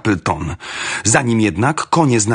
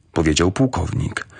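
plain reading voice

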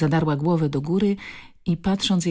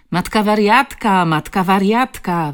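loud, higher taunting chant; teriakan mengejek; 4 semitones higher, 7 dB louder than usual. A narrator's reading. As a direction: yell it out as a bully's jeer. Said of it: "wydarł się najwyższy z grupki".

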